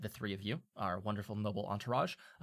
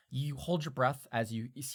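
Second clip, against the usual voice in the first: normal talking voice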